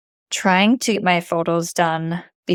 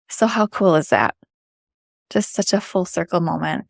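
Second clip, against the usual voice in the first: ordinary talk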